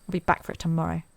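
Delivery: ordinary talk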